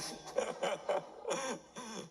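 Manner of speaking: a short amused laugh at own joke. Real, not acted. Lecturer laughing at own ChatGPT joke, amused laugh. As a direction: laugh it off lightly.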